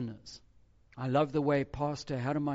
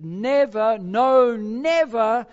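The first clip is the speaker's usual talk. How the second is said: loud and higher, hammering each never; forceful refusal, never stressed hard; emphatic never; 6 semitones higher, 10 dB louder, slower than usual. Shown as a demonstration. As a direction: drive every never home. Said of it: "That's how emphatic that word in the Greek is".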